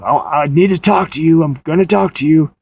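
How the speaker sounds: mock aggressive demand through a door. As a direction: press hard to be let in, half-acted. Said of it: "like, aggressively".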